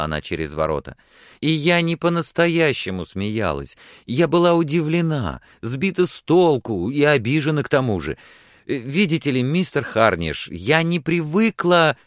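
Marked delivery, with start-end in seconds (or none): 1.4-12.0 high, louder, flustered explanation; lively, impulsive. A narrator's reading. Repentant woman explains her laughter to him, impulsively. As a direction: blurt it out with animated remorse. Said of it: "по свойственной ей живости".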